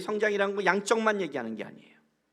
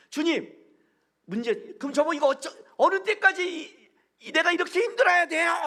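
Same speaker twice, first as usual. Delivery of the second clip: much higher and louder, a pleading whine; whiny complaining prayer; 13 semitones higher, 8 dB louder than usual. Retold or imitated. Youth griping to God in prayer, high loud whine. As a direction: imitate a young believer griping at God.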